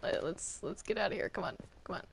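usual speaking voice

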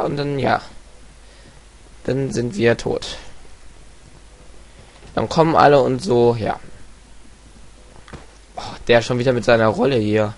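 ordinary delivery

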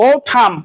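ওঠাম ending on m with the n silent; careful ওঠাম, aspirated t, no n; correct pronunciation. Shown as a demonstration. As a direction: start ওঠাম on a clear o. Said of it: "এন এর উচ্চারণ নাই".

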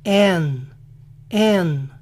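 "N" said as "an", with fronted A; drawn-out "N" as in American "Ann"; English A before N. Shown as a demonstration. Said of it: "es a menudo pronunciada así".

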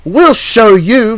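high, loud, slow retort; outraged coach voice, drawn out; indignant threat. A narrator's reading. Insulted coaches vowing revenge on Toby, indignant.

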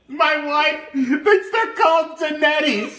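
high giggling through the words; laughing. Real, not acted. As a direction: giggle out the word Donettis. Imitating wife's word, laughing.

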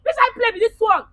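high, loud tearful yelling; crying shout. Real, not acted. Upset woman yelling tearfully at the apologetic stranger. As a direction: push him away, crying and shouting. Victim refuses helper, upset.

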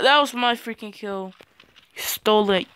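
ordinary talk, nothing marked

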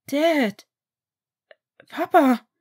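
high, loud burst of joy; overjoyed cry. A narrator's reading. Sam seeing his fathers again, loud excited cry. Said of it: "stotterte er".

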